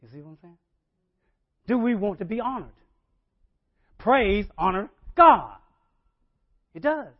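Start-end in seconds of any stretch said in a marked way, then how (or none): 1.7-7.1 rising preacher shout; preaching. Acted. Preacher rousing the congregation, loud and rising. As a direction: build it up and hit God hard.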